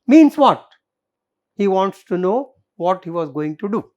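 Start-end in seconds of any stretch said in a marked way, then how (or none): 0.0-0.7 challenging demand. Acted. Intruder challenging threat.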